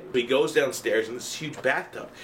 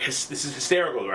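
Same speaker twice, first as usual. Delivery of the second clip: normal talking voice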